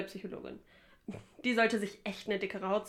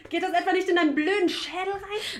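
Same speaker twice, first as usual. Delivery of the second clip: angry insult; 7 semitones higher, 4 dB louder than usual. Acted. Insulting colleague in a quarrel.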